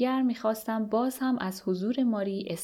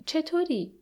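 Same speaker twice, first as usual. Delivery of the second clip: mildly raised, higher-pitched call; a restrained, narrated loud greeting; 8 semitones higher than usual. A narrator's reading. Marie calls to the prisoner across the noisy visiting room, mildly loud. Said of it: "با صدای بسیار بلند".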